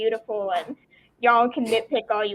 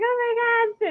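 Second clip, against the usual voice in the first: much higher, giddy squeal of delight; fangirl excitement; 12 semitones higher, slower than usual. Retold or imitated. Recalling seeing Anakin on screen, a giddy high-pitched squeal. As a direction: gush like a little girl seeing her hero. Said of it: "I was like".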